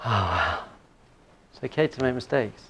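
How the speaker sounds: soft, low sigh of relief; relieved child. Retold or imitated. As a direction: let it out softly, imitating a relieved child. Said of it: "her whole body was like".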